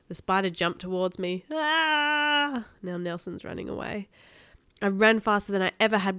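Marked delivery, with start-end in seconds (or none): 1.5-2.7 high, drawn-out "aah" of alarm; narrator's mild scream, held long and high; acted scream. A narrator's reading. Hero screams as spider jumps.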